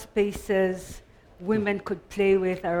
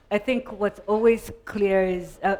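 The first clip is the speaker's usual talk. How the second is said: usual speaking voice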